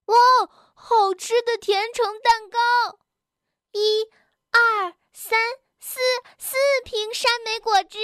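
put-on high, delighted childlike voice; excited child. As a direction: marvel and count like a thrilled kid. Children discover cake and juice. (高亢童声，惊喜地数着)

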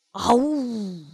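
small tearful roar; little dinosaur roar. Acted. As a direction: roar as you charge. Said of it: "梁太吼叫着".

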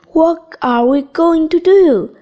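mildly shouted question, voice lifted; narrated shout. A narrator's reading. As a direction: read it as a modest cry for help. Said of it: "shouted Biff".

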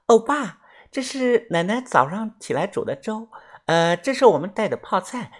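put-on high, soft girlish voice; cheerful Korean girl. A narrator's reading. Girl brings porridge to the narrator, high girlish voice. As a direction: lightly play a sweet young girl. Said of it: "她笑着把碗放下， 说道".

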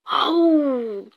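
long "awoo" roar; lion's scare roar. A narrator's reading. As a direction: howl out to frighten someone. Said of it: "狮子先跳了出来".

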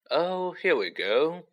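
slow, high, weary bitterness; bitter. A narrator's reading. Ghost host hears the hunting horn, bitter. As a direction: drag it out resentfully. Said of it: "said Nearly Headless Nick bitterly".

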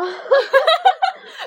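大笑. Real, not acted. Fart joke, bursts out laughing.

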